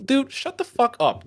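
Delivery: high, drawn-out exasperated dismissal; exasperated retort. Acted. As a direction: cut off a whiner, stretching it out high. Snaps back at the imitated whiner.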